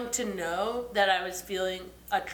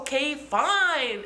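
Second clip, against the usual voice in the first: drawn-out, higher "all right then"; grudging surrender; 6 semitones higher, 4 dB louder, much slower than usual. Retold or imitated. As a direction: give in with exaggerated reluctance. Giving in to their urging.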